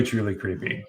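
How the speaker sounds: ordinary talk, nothing marked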